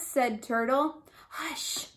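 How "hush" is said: hushed, voiceless shush; whispered. A narrator's reading. Rabbit hushing Turtle above the lion, whispered. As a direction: whisper it softly, a secret warning. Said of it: "whispered Rabbit".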